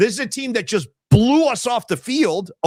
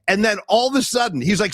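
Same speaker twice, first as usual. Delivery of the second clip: ordinary delivery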